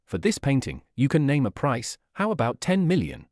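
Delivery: ordinary delivery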